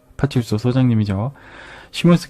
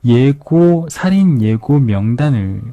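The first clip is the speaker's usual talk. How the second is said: ordinary delivery, nothing marked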